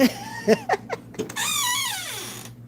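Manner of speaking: squealing laugh that trails off; high, giggly squeal of laughter; high-pitched laugh. Real, not acted. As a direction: laugh way up high, then let it fade. Laughing after a playful "Ding.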